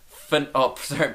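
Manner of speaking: ordinary delivery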